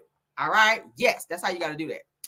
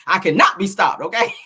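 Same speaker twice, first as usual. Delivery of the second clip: mock defiance; 2 semitones lower, 6 dB louder than usual. Mock defiant declaration to viewers.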